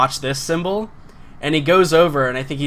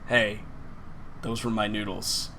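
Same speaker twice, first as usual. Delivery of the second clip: low, soft, understated objection; meek protest; 6 semitones lower, 9 dB quieter, slower than usual. Acted. Diner to the person who took his noodles, quiet protest. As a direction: speak up only quietly, pitched down.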